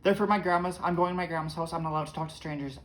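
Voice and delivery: put-on high, loud and rushed little girl; hurried child brushing off a stranger.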